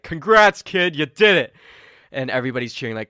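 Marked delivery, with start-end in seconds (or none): 0.0-1.5 loud, higher, hearty praise; cheerful congratulations. Acted. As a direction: slap him on the back with it. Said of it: "Turi's just like".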